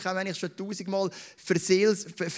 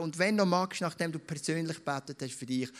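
normal talking voice

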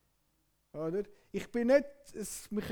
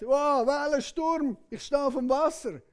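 raised, louder wonder; awed amazement; 5 semitones higher, 7 dB louder than usual. Acted. Peter on the water noticing waves and storm, raised amazement. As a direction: gasp it out, stunned.

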